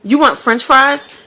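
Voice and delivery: eager chef. Retold or imitated. Cafeteria chef pointing at her, offering fries eagerly. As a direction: call out the offer like a keen cook. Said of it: "He's like".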